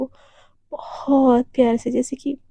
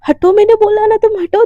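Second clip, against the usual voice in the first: sharp, higher and louder command; angry shove-off; 7 semitones higher, 7 dB louder than usual. Acted. Devika shouting at Radhika to get away, angry. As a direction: order her to get away now.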